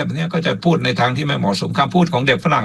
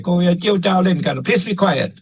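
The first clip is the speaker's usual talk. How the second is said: higher-pitched imitation of a classroom teacher; teacher hushing children; 6 semitones higher, slower than usual. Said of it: "เหมือนกับครูกำลังสอนเด็ก แล้วเด็กโวกเวก". (เสียงครูสั่งเด็กที่โวกเวกให้เงียบ)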